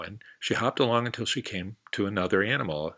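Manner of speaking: ordinary delivery, nothing marked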